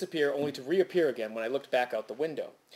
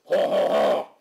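booming, louder, higher cartoon greeting; cartoon lumberjack; 4 semitones higher, 9 dB louder, slower than usual. Narrator imagines landlord as cartoon axeman greeting people, booming. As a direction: boom it out like a stocky cartoon woodsman. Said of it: "Start conversations with".

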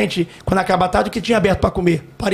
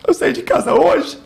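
slow, higher-pitched mock crying; imitated tearful voice; 6 semitones higher, slower than usual. Cleaning lady confessing to her young benefactor, imitated tearful voice. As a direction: draw it out high, imitating someone in tears. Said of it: "Chorando demais, e ela falou assim".

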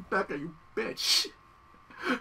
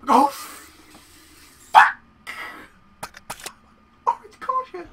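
alarmed shout; 12 semitones higher, 7 dB louder, much slower than usual. Real, not acted. Health drops suddenly.

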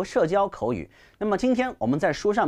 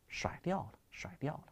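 mock echo, dropping away much softer; fading echo; 14 dB quieter than usual. Teacher echoing the breakup line for effect, fading away softly. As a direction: repeat it ever softer like an echo.

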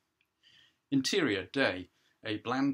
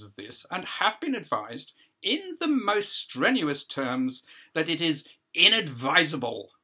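higher, louder voice of a self-important judge; judge addressing a hearing; 7 semitones higher, 6 dB louder than usual. Acted. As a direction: play the judge holding court, a pitch up and projected.

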